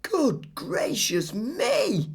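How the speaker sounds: high, slow astonished cry; gasped exclamation. Acted. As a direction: gasp it out in shock. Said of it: "gasped Paul".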